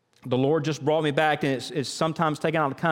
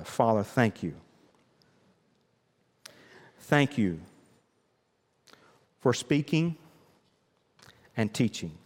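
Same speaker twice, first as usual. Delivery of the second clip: closing prayer. Acted. Pastor praying over the congregation, hushed and earnest. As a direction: pray it gently with heads bowed.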